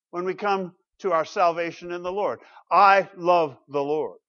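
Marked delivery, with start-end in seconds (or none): 2.7-4.2 slow and loud, weighing each word; mean every word of it; slow heartfelt declaration. Shown as a demonstration. Pastor repeating the confession for the flock, slow and sincere. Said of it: "Maybe you can say that".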